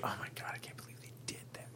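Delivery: quick, hushed whisper; appalled whisper. Retold or imitated. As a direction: imitate scandalized parents whispering to each other. Appalled parents murmuring behind the family.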